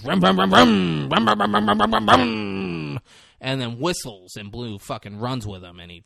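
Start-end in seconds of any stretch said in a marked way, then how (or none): 0.0-3.0 high, drawn-out engine imitation; dirt bike revving. Chris Pratt pulls up on his dirt bike, mimicked revving. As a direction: rev it like a motorbike. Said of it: "pulls up on his dirt bike and goes".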